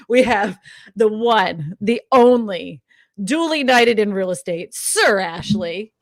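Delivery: slow, loud, drawn-out showman build-up; grand announcer intro. Acted. Host presents her guest as royalty, slow and loud announcer style.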